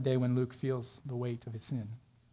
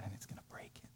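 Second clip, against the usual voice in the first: real tears, breaking into a whisper; barely whispered, choked up; emotional whisper; 5 semitones lower, 19 dB quieter, faster than usual. Real, not acted. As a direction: voice nearly gone with emotion. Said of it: "I'm gonna get emotional".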